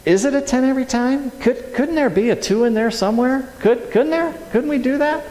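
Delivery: high, pleading questions; coaxing spirit voice. Retold or imitated. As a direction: gently press for a smaller reaction. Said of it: "just sitting there and going".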